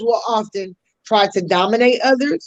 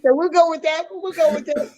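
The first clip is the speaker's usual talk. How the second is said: playful retort; 6 semitones higher than usual. Retold or imitated. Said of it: "I said".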